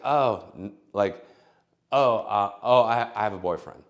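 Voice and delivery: mock flustered brush-off. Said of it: "she's like".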